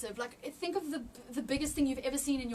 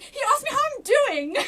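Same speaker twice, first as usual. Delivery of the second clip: much higher and louder, laughing through the words; laughing disbelief; 12 semitones higher, 11 dB louder than usual. Real, not acted. Telling her friend what God asked, laughing in disbelief. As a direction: laugh it out, still stunned.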